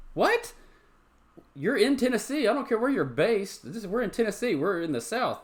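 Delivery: outraged rant at the restaurant's policy. Real, not acted. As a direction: let the outrage boil over. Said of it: "Off that rant".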